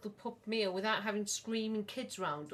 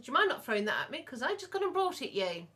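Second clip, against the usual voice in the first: fast, louder mock-indignant snap; indignant retort; 6 semitones higher, 7 dB louder, faster than usual. Mum retelling telling her husband off for throwing food, fast and affronted. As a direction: fire it back at him, affronted.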